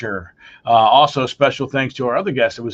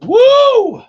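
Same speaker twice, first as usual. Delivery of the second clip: loud high cheer; excited whoop; 9 dB louder than usual. Real, not acted. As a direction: whoop it up in celebration. Cheering after the farewell toast.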